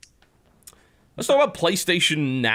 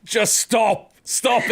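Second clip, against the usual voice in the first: exasperated mock plea; 9 semitones higher, much slower than usual. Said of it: "It's like".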